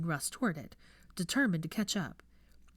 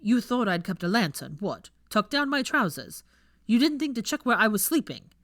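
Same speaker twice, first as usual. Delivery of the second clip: sarcastic scolding; 4 semitones higher, 7 dB louder than usual. Acted.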